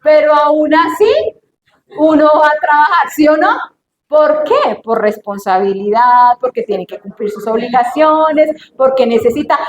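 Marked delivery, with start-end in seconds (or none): none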